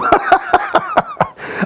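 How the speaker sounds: amused chuckling; laughing. Real, not acted. Host amused after clowning for a fan, laughing. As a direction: laugh at your own silly act.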